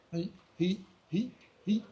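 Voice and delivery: short "he, he" tongue pulses; clipped honking "he" syllables; duck call honks. Shown as a demonstration. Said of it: "using a jaw movement".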